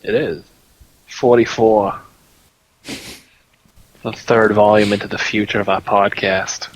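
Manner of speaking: put-on hammy New England announcer accent. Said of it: "you do that New England accent way too well".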